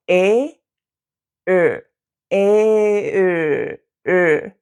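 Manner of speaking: A gliding into Ö by rounding lips; A then rounded Ö. Shown as a demonstration. Teacher showing how A becomes Ö. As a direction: keep tongue, round lips from A to Ö. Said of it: "move your lips to a rounded position".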